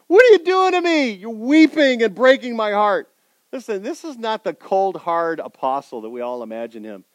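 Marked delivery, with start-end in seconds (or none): 0.1-3.1 high, loud, hurt plea to friends; anguished plea. Acted. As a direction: heartbroken cry to weeping friends. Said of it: "He goes to them".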